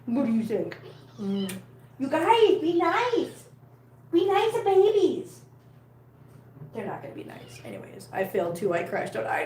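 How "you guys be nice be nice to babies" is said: high, drawn-out, swooping plea; pleading pet scolding. Real, not acted. Carer telling cats be nice, pleading scolding. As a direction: coax squabbling pets in a high voice.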